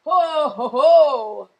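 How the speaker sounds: a hint of Santa chuckling. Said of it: "Santa said".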